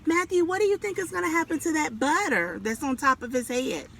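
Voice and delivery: plain reading voice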